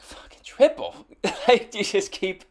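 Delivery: high, laughing incredulous retort; amused mock astonishment; laughing disbelief. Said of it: "I'm like".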